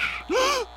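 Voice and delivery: high sharp gasp; shocked gasp. Acted. Gasp in news bit.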